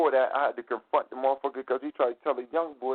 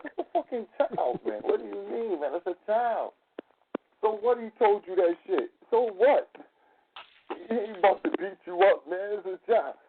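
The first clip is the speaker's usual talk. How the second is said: higher, louder indignant scolding; retold heated argument; 7 semitones higher, 3 dB louder than usual. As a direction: tell him off, outraged for the kid. Retold confronting the store owner.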